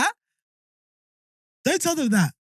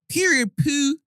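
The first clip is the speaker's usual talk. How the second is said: higher-pitched American catchphrase; mock American slang; American accent; 6 semitones higher, slower than usual. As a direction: put on a sassy American accent. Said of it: "we say American words".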